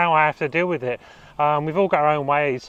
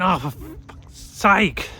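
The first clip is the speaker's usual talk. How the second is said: slow, drawn-out fed-up sigh of words; exasperated groan; 3 semitones higher, much slower than usual. Retold or imitated. Friend reminded of his tinnitus, slow exasperated groan. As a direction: let it drag out like you've just been reminded of the ringing.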